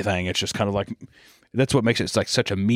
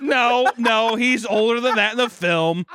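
high-pitched, flustered denial; high protest; 11 semitones higher, slower than usual. Real, not acted. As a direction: defend yourself, higher. Protesting a joke.

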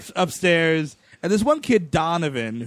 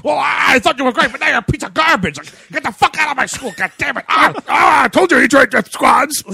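loud, higher ranting principal; hammed-up fury at the teacher; mock angry principal; 6 semitones higher, 6 dB louder than usual. Principal firing the teacher in his office, mock furious rant. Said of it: "pulled into the principal's office".

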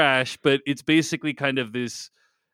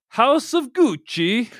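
slow hammy Italian accent; 7 semitones higher, slower than usual. Shown as a demonstration. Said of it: "that Italian accent I just did".

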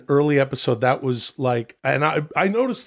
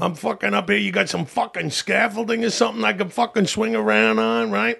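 higher-pitched Long Island loudmouth; Long Island regular giving his opinion; Long Island accent; 5 semitones higher than usual. Acted. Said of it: "that's a Long Island accent".

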